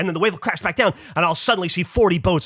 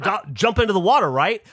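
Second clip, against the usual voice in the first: usual speaking voice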